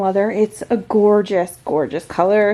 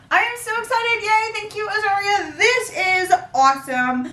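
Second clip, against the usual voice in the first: high, giddy squeal; thrilled, singsong high voice; real excitement; 7 semitones higher than usual. Real, not acted. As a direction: gush it out high, overjoyed and grateful.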